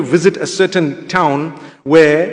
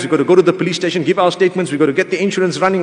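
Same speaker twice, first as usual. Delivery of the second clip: rattled-off list; faster than usual. Retold or imitated. Woman rattling off the procedure.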